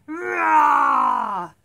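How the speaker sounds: very loud drawn-out roar; shouted animal roar; lion roar. Pretend. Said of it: "Roar like a lion".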